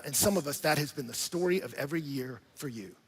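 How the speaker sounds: ordinary talk, nothing marked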